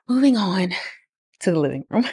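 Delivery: slightly winded, breathing between words. Real, not acted. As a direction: talk while catching your breath. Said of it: "I don't know why I'm so out of breath".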